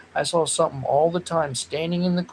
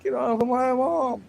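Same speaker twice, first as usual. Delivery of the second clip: put-on much higher kid's cry; child yelling; 10 semitones higher than usual. Host retelling bursting in as a child, high kid's yell. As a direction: yell at them as a little kid. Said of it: "being like".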